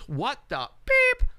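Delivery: high, slow, startled "what the beep"; startled outburst. Retold or imitated. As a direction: blurt it out like a sudden shock. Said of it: "he looked over and he goes".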